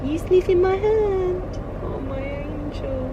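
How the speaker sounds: high, slow cooing to the dog; pet talk. Real, not acted. Talking to her dog.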